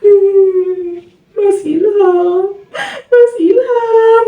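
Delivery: high, very slow sobbing wail; crying again. Retold or imitated. Possessed wife answers the ustadz with slow high sobs. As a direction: drag the name out in a high wail. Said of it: "malah nangis lagi si Dewi".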